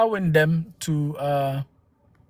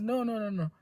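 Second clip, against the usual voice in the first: soft refusal; 5 semitones higher, 12 dB quieter than usual. Real, not acted. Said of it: "I will not speak like that".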